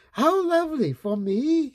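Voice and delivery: high, bright delight. A narrator's reading. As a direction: gush over the flowers. Mother delighted by flowers.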